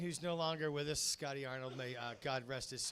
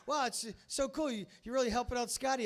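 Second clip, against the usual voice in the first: impressed customer; 8 semitones higher, 4 dB louder than usual. Retold or imitated. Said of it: "people would be like".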